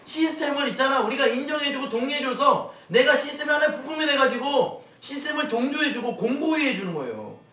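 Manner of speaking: loud, high fervent preaching; preaching at full force. Acted. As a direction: drive it home to the congregation, loud and high. Preaching to congregation.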